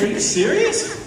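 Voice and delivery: high, laughing disbelief; can't believe the sheriff means it; incredulous. Retold or imitated. Talking to the sheriff at the door, laughing disbelief. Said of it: "I'm like".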